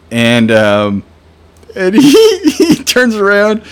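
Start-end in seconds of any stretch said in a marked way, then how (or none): 1.8-3.6 high, loud words through laughter; helpless laughter, slowing down; laughing. Real, not acted. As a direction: struggle to tell it while cracking up. Laughing while telling the story.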